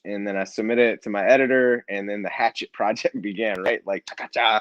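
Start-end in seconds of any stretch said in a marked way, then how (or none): 3.9-4.6 high, quick sing-song cha-cha-cha; playful cha-cha-cha. Acted. Guest celebrating the book project's start, playful high cha-cha-cha. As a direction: toss off a high playful little flourish.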